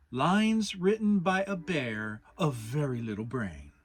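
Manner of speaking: poem recital. Acted. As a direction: recite it like proud Pooh. Pooh recites his poem.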